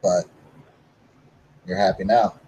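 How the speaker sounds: ordinary talk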